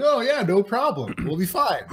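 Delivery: high carefree quote; breezy. Retold or imitated. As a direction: brush it off lightly. Own past self quoted.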